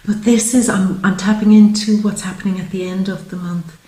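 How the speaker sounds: ordinary delivery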